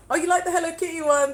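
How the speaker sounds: high, bright squeal of delight at a comment; light up at the viewer's compliment; delighted surprise. Real, not acted. Streamer thrilled a viewer likes her Hello Kitty video, delighted high squeal.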